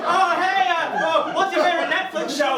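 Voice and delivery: high, loud, badly contained small talk; straining to rein in. Comic texting a date while holding himself back, strained casual. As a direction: force casual chat over a bursting intensity. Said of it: "have to try and cave in that in".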